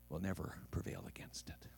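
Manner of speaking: very soft, low proclamation; hushed solemn promise. Acted. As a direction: drop almost to a whisper for weight. Solemn climax of quoted promise.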